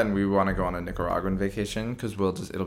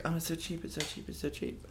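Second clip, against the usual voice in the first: mock excited tourist; 8 semitones higher, 13 dB quieter than usual. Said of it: "it's like".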